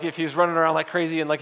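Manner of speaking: ordinary delivery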